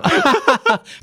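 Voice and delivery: high bright burst of laughter. Real, not acted. Laughing at joining idea.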